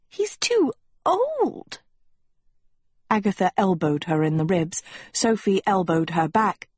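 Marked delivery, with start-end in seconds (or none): 0.1-1.8 very high, drawn-out squeaky objection; slow, high-pitched complaint; whispered protest. Acted. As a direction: protest in a high, stretched-out hiss. Sophie whispers protest to Agatha.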